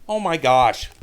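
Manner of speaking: loud sudden "oh my gosh"; a loud gasp of dismay; startled outburst. Real, not acted. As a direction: blurt it out in shock. Sudden mishap in the game.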